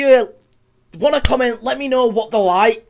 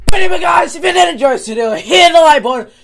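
vlog outro; 6 dB louder than usual. Acted. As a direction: pump up the viewers for subscribe. YouTube outro.

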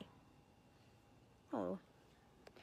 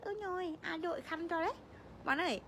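ordinary talk

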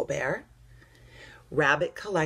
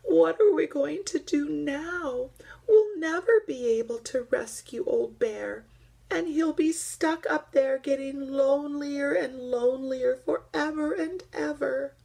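tearful; 11 semitones higher, 3 dB louder than usual. Acted. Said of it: "Duck began to cry".

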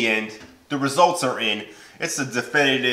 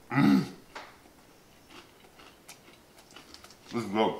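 chewing while talking; muffled through food; mouth full; 3 semitones lower, 6 dB quieter than usual. Real, not acted. Seen 0: Bites crisp at -1.0, chews with closed, bulging cheeks throughout.

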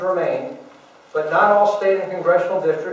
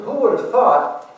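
higher and slow, drawn out in disbelief; amazed wonder; 5 semitones higher, slower than usual. Real, not acted. To listeners, marvelling at the Republican comeback, in high slow wonder. Rhetorical marvel at surprise win.

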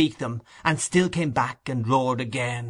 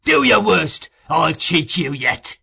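loud, desperate ruffian's taunt; menacing shout, slowing at the end; menacing defiance; 4 semitones higher, 6 dB louder, slower than usual. A narrator's reading. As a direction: defy the mob, starting high and loud. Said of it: "menacing the crowd".

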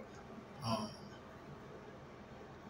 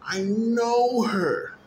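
very slow, higher and louder, each word drawn out; emphatic insistence; 9 semitones higher, 7 dB louder, much slower than usual. Real, not acted. Telling his brother he knows his sister, slow and loud. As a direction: stretch every word to hammer it home.

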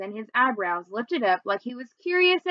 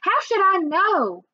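higher, slow, sharp retort; snapped; 9 semitones higher, 4 dB louder, slower than usual. A narrator's reading. Georgina snapping at Toby, higher and sharp. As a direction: snap back at him, slowly and pointedly. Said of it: "I snapped".

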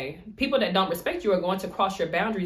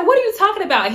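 high, louder flustered protest; mock defensive denial; 11 semitones higher, 7 dB louder, faster than usual. Friend denying the crush, high and loud. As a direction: act out a flustered friend brushing it off.

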